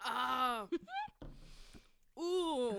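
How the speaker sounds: gasping after a strong shot; the burn of a shot just downed; after a shot of liquor. Real, not acted. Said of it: "hast du gerade Schott getrunken".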